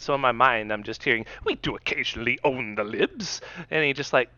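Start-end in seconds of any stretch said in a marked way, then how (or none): 1.4-3.4 put-on British accent, slightly softer; TotalBiscuit. British interviewer as the host imagines him, mock British accent. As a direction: do a British commentator's voice. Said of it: "I picture him talking like Total Biscuit".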